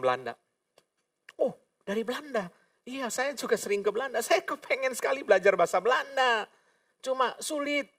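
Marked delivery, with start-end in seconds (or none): 1.4-7.9 high-pitched, chatty enthusiasm; feigned eager interest. Retold or imitated. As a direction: charm a seatmate with playful, high-voiced interest. Memerankan obrolan ramah dengan orang Belanda. (pura-pura antusias, nada tinggi)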